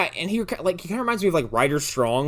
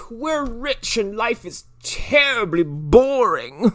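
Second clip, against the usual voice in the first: slow, higher, hammed-up snob; bored rich kid; 11 semitones higher, much slower than usual. Mocking the rich side characters, slow snobby drawl. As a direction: drag it out, mock aristocratic. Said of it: "They're sitting there like".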